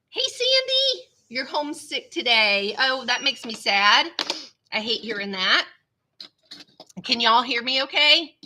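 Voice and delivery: normal talking voice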